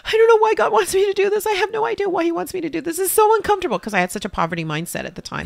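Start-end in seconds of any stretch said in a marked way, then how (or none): 0.1-3.8 high, loud, fast distressed wail; retold sobbing protest. To her pastor, retelling her tearful panic, high and loud. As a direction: pour out the panic through tears. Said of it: "I was sobbing and sobbing".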